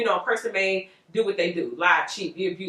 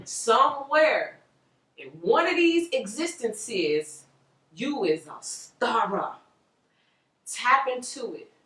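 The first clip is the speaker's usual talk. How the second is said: dreamy slow; 4 semitones higher, slower than usual. Acted. Dreamy call to your star self.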